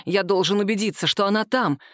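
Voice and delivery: ordinary delivery, nothing marked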